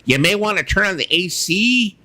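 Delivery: high put-on announcer voice; mock Richard Dolan giving advice. Friend imitating Richard Dolan's advice, high put-on. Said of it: "mixture of Roseanne Barr and Richard Dolan".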